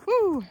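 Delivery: high loud whoop; excited woo. Real, not acted. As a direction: whoop with joy. Cheering on the trail.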